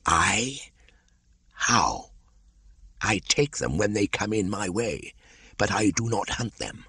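high, slithery python voice; a wary old snake, curious; a lightly hissing old python; Kaa the python. Acted. Kaa's voice in reading.

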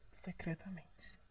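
soft secret whisper. Acted. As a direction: drop to a hushed whisper. Confiding nickname secret. (sussurro secreto)

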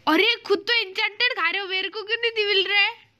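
high-pitched protest all through; indignant complaint. Real, not acted. Player complains to friend about enchanted food, indignant complaint. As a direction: whine that it's unfair.

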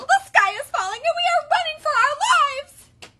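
very high, loud huffing chick panic; Chicken Little. A narrator's reading. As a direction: squeal it in frantic alarm. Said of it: "huffed Chicken Little".

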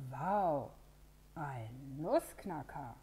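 a drawn-out, slow "Nussknacker" in awe; amazed. Acted. All the animals marvel at Pino's nutcracker, slowly awed. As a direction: let the wonder stretch the word "Nussknacker". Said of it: "Alle staunen".

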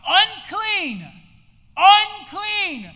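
high, loud cry of warning; lepers' warning shout. Acted. Lepers warning passersby away, shouting. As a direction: shout it out for all to hear. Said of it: "required by law to shout".

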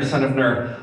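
usual speaking voice

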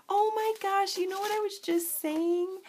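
light up, it just clicked; excited surprise. Real, not acted. Sudden recognition of product.

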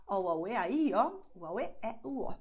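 O, u, a, u, e said in one breath, loud; lively vowel demo; vowel sentence demo. Shown as a demonstration.

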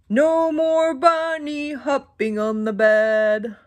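slow, lightly voiced stern doctor. Doctor scolding the bunnies, slow and a touch stern. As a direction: wag a finger slowly, a hint of a stern doctor. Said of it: "the doctor said".

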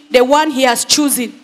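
usual speaking voice

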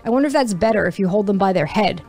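ordinary delivery

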